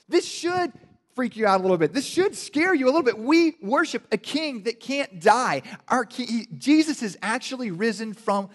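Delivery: high, loud sermon climax; preacher rising to a loud peak; full-force preaching. Real, not acted. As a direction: drive it home to the congregation.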